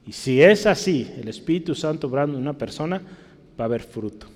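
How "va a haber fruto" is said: quiet. Shown as a demonstration. Preacher sets up the repeat.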